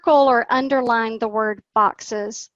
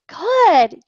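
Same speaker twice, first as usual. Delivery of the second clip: high, loud good; a bright, enthusiastic good; cheerful praise; 6 semitones higher, 5 dB louder than usual. Shown as a demonstration. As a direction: cheer the child on. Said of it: "praising the student".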